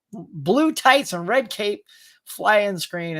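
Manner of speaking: usual speaking voice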